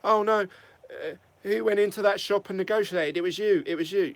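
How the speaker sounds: mock Apprentice squabble. Vlogger mocking the CEOs' squabble as an Apprentice boardroom row. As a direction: play a bickering reality-show contestant, pitched well up. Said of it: "something you would see on The Apprentice".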